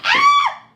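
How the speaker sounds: high, loud shriek of terror; scream. Acted. Red sees the wolf and screams. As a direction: scream in fright.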